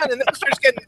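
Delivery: ordinary talk, nothing marked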